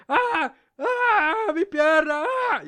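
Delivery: high, loud, hammed-up cries of pain; mock pain scream. Player faking an injury, hammed-up high loud screams. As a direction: overact a player's agony after a fall. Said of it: "el man se tira y es como que".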